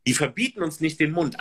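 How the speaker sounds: ordinary delivery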